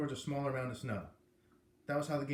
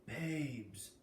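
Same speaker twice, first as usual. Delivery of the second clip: leaning in; 6 dB quieter than usual. A narrator's reading. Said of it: "I leaned in and said".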